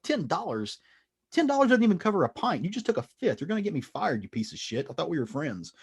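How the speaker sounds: high, indignant squeal of disbelief; hammed-up outrage at a friend who stole; outraged retold rant. Todd outraged at thieving friend.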